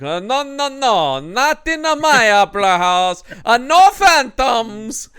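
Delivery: high, loud, wailing Italian refusal; over-the-top cartoon Italian. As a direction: protest in a hammed-up Italian accent. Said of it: "over-the-top cartoon Italian".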